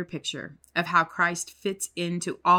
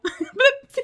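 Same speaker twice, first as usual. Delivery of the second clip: loud, high burst of laughter; giddy squeal; 6 dB louder than usual. Real, not acted. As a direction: shriek with delight. Laughing at own singing.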